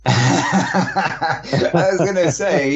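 laughing. Real, not acted. Laughing at caller's joke.

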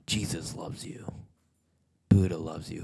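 ordinary talk, nothing marked

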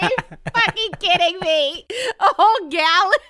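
high, laughing mock outrage; laughing while retelling her reaction; laughing disbelief. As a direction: laugh through the exasperation.

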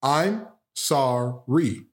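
"I'm sorry" drawn out very slow; slow demo. Shown as a demonstration. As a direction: lay out the three syllables one by one. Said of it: "Watch this".

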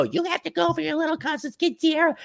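high, soft scolding mom voice; nagging mom. Retold or imitated. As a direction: play a mother ordering her son to go. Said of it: "your moms are bludgeoning you".

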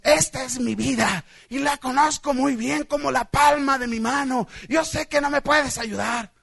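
defiant mock boast, slowing phrase by phrase; self-sufficient believer. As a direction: act out stubborn self-reliance.

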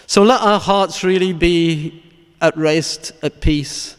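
measured, fervent sermon close; solemn preaching. Real, not acted. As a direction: preach it weightily, phrase by phrase, letting each one fall. Preaches a closing meditation on Christ's death.

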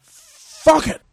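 loud, high frustrated swearing; angry outburst. Real, not acted. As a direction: blurt it out in pure frustration. Frustrated outburst before recording.

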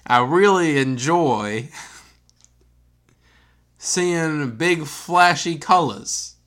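put-on slow New York accent sliding toward southern; accent drifting southern. Host's fake New York accent turns southern. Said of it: "Sound kinda southern".

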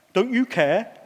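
a hint of a sulky child, petulant whine. Preacher mimicking disciples as a sulky child, mildly petulant. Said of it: "The kind of thing that a sulky child might say".

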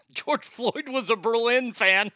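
high laughter-filled line, then a shrieking laugh. Real, not acted. Host losing it laughing at his own meme joke. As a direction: crack up at your own joke.

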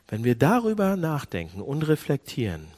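ordinary talk, nothing marked

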